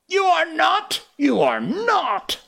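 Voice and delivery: high, loud, hoarse denial; hoarse cry. Acted. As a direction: cry it out raw. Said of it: "Hook cried hoarsely".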